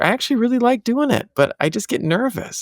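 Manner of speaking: lightly voicing his nervous daughter; daughter quoted. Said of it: "she's like".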